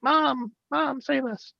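high, thin mock child's plea; children crying out. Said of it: "you hear the children screaming in the background".

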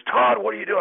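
high, loud yell across the court; coach yelling. Retold or imitated. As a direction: shout at the player from the sideline. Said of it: "The coach is yelling".

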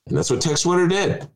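ordinary talk, nothing marked

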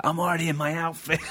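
high, gleeful boast; a performer already in costume, high and gleeful; childlike excited voice. Retold or imitated. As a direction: brag like an eager child. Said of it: "he'd be like".

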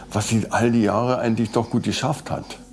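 ordinary talk

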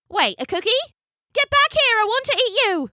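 high eager tourist voice; suddenly hungry tourist; excited tourist. A narrator's reading. Tourist character speaks.

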